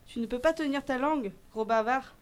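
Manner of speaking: elfe fâché, plus fort et plus aigu; cri de reproche. A narrator's reading. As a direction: rabrouer un bavard en haussant le ton. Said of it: "s'écria l'elfe". (reproche crié d'elfe)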